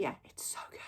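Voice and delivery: soft secretive whisper; hushed aside. Real, not acted. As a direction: whisper it like a confession. Whispered aside after raving.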